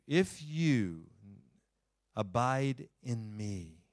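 slow, deliberate reading with long pauses; slow scripture reading. Shown as a demonstration. Pastor reading John fifteen to a congregation with closed eyes, slow and meditative. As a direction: lay each phrase down slowly for eyes-closed listeners. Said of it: "I'll read it slowly".

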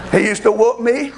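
ordinary talk

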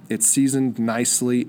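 ordinary talk, nothing marked

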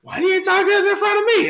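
high squeaky mock wife voice; imitating wife. Imitating his wife's indignant question, high and squeaky. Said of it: "And so then she be like".

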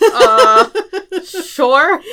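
high, loud, laughing uh then unsure sure; loud, laughing, unsure answer to the man at the window; startled hesitant sure. Retold or imitated. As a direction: caught off guard, half laughing. Hesitant reply to a stranger.

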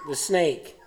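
ordinary talk

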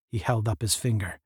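ordinary delivery, nothing marked

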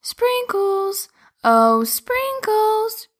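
high, slow calling out; shouting. Acted. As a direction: stretch the name out as if calling far.

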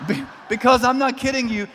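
ordinary talk, nothing marked

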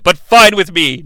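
normal talking voice

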